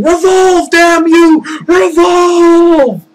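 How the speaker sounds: high, loud frantic scream. Retold or imitated. Two brothers screaming at a stuck revolving door, frantic shout. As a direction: yell it at the stuck door in desperation. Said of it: "just sitting there just screaming".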